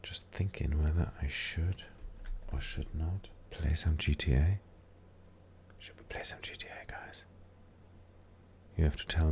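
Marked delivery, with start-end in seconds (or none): 0.0-7.2 hushed whisper to the viewers. Real, not acted. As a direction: whisper it like a secret. Asks viewers in hushed aside.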